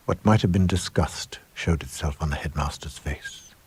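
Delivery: usual speaking voice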